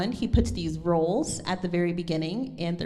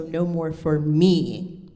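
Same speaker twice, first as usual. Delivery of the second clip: slow and loud, "me" held long; slow line with a strong, lengthened "me"; emphasis on me; 7 dB louder, much slower than usual. Shown as a demonstration. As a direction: land heavily on a drawn-out "me". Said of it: "Me is a very personal word".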